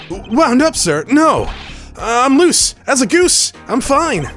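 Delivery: put-on nervous young lawyer. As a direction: overeager and panicky, trying to sound fine. Voices Apollo nervously.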